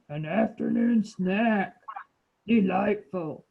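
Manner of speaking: slow, measured lion; a hint of a lordly lion, drawn out. Shown as a demonstration. Teacher showing a child how the lion talks, slow lion voice. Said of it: "say it like you were the lion".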